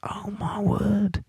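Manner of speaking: slow, hushed exclamation; appalled aside. Real, not acted. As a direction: breathe it out softly, aghast. Appalled reaction to quoted line.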